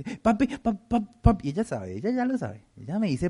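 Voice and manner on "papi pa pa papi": put-on higher, sing-song little girl begging papi; coaxing little daughter. Daughter sweet-talking her dad to get something, higher and coaxing. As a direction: wheedle like a small girl after a favour.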